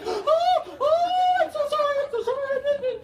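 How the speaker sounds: very high, loud mock wailing apology; hammed-up panicked lady begging sorry; shrill, loud overwrought sorry; frantic apology.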